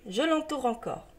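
usual speaking voice

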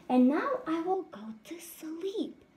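hushed sleepy mouse whisper. Acted. Mouse tricks bees by going to sleep, whispered. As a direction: whisper it like nodding off.

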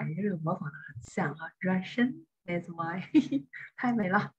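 usual speaking voice

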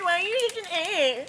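high, wobbly mock voice; silly high-pitched put-on voice; bad impression. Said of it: "That was the worst impression".

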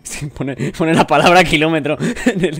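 talking through a laugh. Real, not acted. Player reporting the odd word on screen, laughing. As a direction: giggle while trying to explain. Cracks up at the game text.

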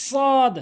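সদ with a heavy s and long held vowel; tongue tip to teeth, stretch a full-mouthed সদ; correct সদ. Shown as a demonstration. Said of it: "একই নিয়মে তিনটি হরফ".

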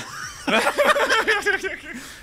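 giggling fit; high burst of laughter. Real, not acted. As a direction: crack up. Reacting to the joke.

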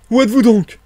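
high, loud shouted question; exasperated demand. Acted. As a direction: demand that the hidden one show himself. Marvel demands where speaker hides.

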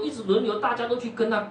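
ordinary delivery

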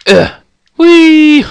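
high, loud squealing whee; silly put-on voice. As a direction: squeal it like a cartoon kid on a ride. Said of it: "I don't know why I put that voice on".

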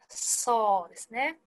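ordinary talk

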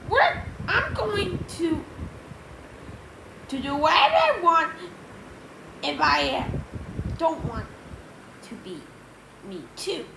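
high, halting character voice; Harold defiant. Acted. Harold rebelling against his double, high and halting.

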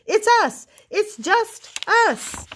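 high, loud chorus of friendly voices; friends calling. A narrator's reading. Friends outside answer Old Armadillo, excited high calls. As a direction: call back eagerly as a crowd of friends.

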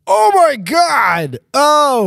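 slow, high, loud mock horror; shocked outcry. Officer uncovering Harry's scar, loud stagey shock. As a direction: stretch out a shrieked gasp of discovery.

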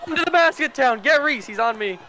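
high loud panicked yells; panicked yelling. Real, not acted. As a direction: scream for help in panic. Chased by the killer.

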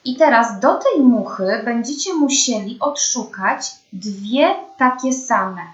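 normal talking voice

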